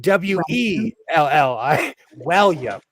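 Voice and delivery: East Coast "[name]" said as WELL-yum; East Coast accent. Shown as a demonstration. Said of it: "on the East Coast, my name is [name]".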